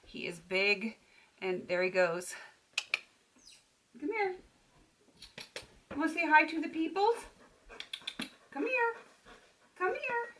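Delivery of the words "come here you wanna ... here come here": sing-song call to the puppy; high puppy-talk voice. Real, not acted. Owner calling her puppy over in high puppy talk. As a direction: coax him over, high and sweet.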